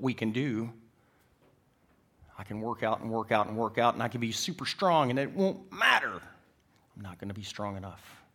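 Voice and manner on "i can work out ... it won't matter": forceful pulpit crescendo; impassioned preaching. Real, not acted. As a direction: preach it harder with every phrase, punching the end. Preaches, building to a peak.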